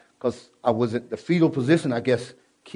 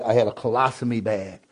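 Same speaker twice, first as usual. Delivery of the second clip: ordinary talk, nothing marked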